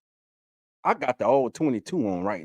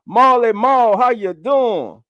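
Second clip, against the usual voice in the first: higher and louder, the name called out in welcome; calling out; 8 semitones higher, 6 dB louder than usual. Real, not acted. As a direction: sing out her name as she arrives. Greeting viewer joining the stream.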